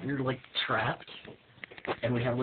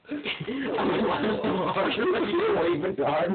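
laughing; 10 semitones higher, slower than usual. Real, not acted. Mocking flailing while laughing.

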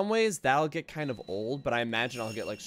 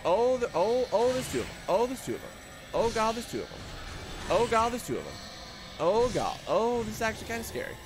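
panicked; 11 semitones higher, 5 dB louder than usual. Real, not acted. Panicking at ghosts in horror game.

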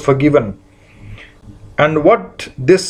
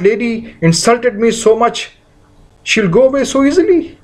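higher, indignant protest of someone wronged; aggrieved indignant voice; 5 semitones higher than usual. Pretend. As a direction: let the grudge rise in disbelief. Voicing a resentful hurt person.

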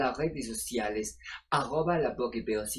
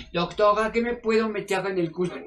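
mock caller voice, a bit louder; listener question; 4 semitones higher, 6 dB louder than usual. Doctor reading out a viewer's cheeky question, playful. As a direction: read the listener's naughty question aloud. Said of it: "mira que nos pregunta".

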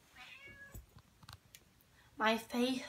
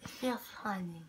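whisper; 4 semitones lower, 11 dB quieter, faster than usual. Real, not acted. Sedated patient whispering.

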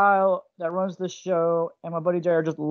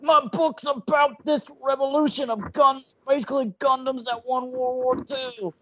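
high, slow gravelly wrestler promo voice; over-the-top wrestling hype voice; Macho Man impression; 7 semitones higher than usual. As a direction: ham it up like Macho Man Randy Savage. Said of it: "gonna do a bad Macho Man impression".

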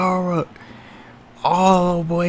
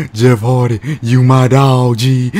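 much lower and louder, a tough guy's greeting; deep put-on wrestler voice; 7 semitones lower, 6 dB louder than usual. Commentator voicing a wrestler hyping his buddy, deep and loud. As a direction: drop low and boom it like a brawler.

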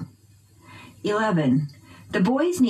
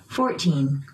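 ordinary talk, nothing marked

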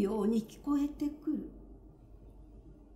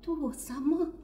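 trembling, higher, faint tearful question; tearful woman; 5 semitones higher, 4 dB quieter than usual. Acted. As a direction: ask with fragile hope through tears. Okaji asks through tears.

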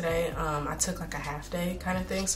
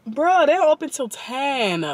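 surprised; 8 semitones higher, 7 dB louder than usual. Real, not acted. Surprised store closed.

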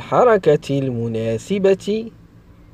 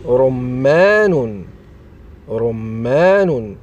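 slow, drawn-out dictation of a word; slow enunciation; much slower than usual. Shown as a demonstration. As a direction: stretch each syllable for young learners. Teacher sounds out example word. (نطق بطيء ممدود للتعليم)

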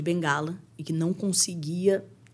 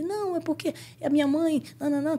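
put-on high little child's voice; imitating small boy; 9 semitones higher, faster than usual. Little boy explaining to his coach about his mother, child voice. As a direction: explain it in a high, reluctant kid voice.

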